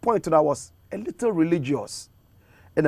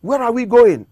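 high, loud cry of disbelief; shocked; 7 semitones higher, 6 dB louder than usual. Retold or imitated. Speaker retells his shock at holy water practices, shocked. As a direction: exclaim it in shock, pitched up. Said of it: "I'm shocked. I'm like".

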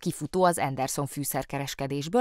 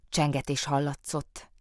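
ordinary delivery, nothing marked

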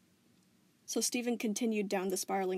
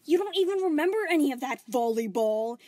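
higher, louder angry snap back; sharp retort; 6 semitones higher, 9 dB louder than usual. Acted. Spinel mocking Volleyball's nickname, angry retort. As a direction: shoot the words back at her. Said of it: "she shot back".

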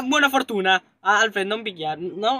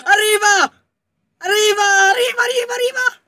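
panicked shout; 7 semitones higher than usual. Real, not acted. Gamer warning her friend the monster is coming, panicked shout. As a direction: scream that it's coming.

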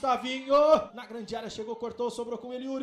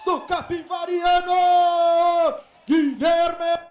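goal scream; 4 semitones higher, 8 dB louder than usual. Real, not acted. Goal call, crowd roaring.

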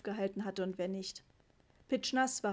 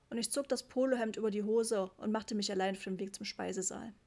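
usual speaking voice

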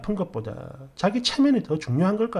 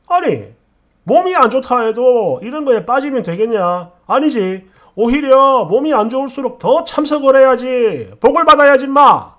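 loud, higher voice building to a shout; scolding father-in-law; 4 semitones higher, 9 dB louder than usual. Acted. Father-in-law dismissing her illness, loud and angry. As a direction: rebuke her with rising force.

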